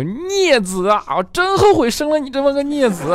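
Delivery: put-on old man cursing his wayward son; wailing dad. Dad laments his son, high loud hammed-up wail. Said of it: "他爸马上就说".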